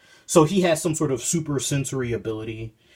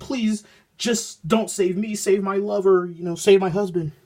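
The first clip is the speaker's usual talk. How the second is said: pleading woman; 6 semitones higher than usual. Retold or imitated. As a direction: beg high and earnest.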